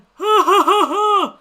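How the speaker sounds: wavering high cry, very loud; loud high wail. Real, not acted. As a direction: wail out in rising and falling waves. Reacts to Attenborough being ninety-seven.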